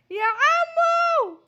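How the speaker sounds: very long, high, loud yell; calling out. Acted. Child customer hails the pharmacist, long loud high call. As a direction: drag the call out at full volume.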